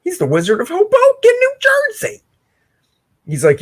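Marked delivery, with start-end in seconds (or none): 0.0-2.2 mock grand announcement. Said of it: "I almost thought that said Hoboken".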